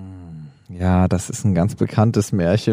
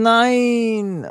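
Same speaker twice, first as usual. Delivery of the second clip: much higher, stunned protest; shocked no; 10 semitones higher than usual. Real, not acted. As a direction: cry out in disbelief. Told the title is wrong. (entsetztes Nein, viel höher)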